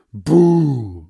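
sudden loud high boo; playful boo. Acted. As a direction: jump out and boo him. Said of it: "macht sie".

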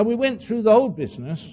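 ordinary talk, nothing marked

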